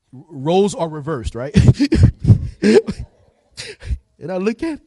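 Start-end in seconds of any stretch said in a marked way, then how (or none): 1.6-3.1 loud, high hammed-up sobbing; imitated uncontrollable crying; breaking-down sobs. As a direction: wail in gasps, overdoing the cry. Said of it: "I don't cry like that though".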